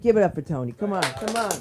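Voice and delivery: ordinary talk, nothing marked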